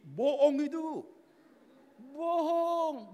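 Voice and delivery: loud, high, drawn-out shout; high, incredulous preacher's yell; calling out a lie. Real, not acted. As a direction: holler it with long stretched vowels.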